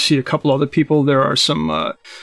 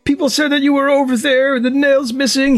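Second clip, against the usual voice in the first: put-on high, strained old man voice; panicked old man; 11 semitones higher than usual. Old man warning the party of doom, high and frantic. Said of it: "He goes".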